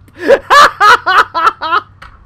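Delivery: high helpless giggle; laughing fit. Real, not acted. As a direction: burst out cackling. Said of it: "Sorry, Squid".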